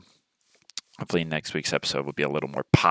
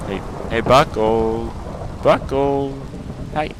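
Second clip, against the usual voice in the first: calling out; 4 semitones higher, slower than usual. Acted. Calling out to neighbor Buckle.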